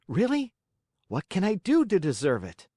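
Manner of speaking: put-on eager boy voice. A narrator's reading. Pinocchio eager.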